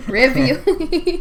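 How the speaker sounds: laughing drawl. Real, not acted. Laughing, drawn-out exclamation.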